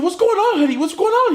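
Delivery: high-pitched mock mom voice; concerned mother. Mother asking her son, high concerned voice.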